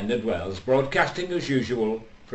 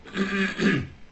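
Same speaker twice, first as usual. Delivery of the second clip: throat clearing; 9 semitones higher than usual. Acted. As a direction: clear your throat for attention. Clearing throat before announcing.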